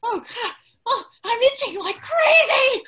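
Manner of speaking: distressed. Acted. Cursed with itching.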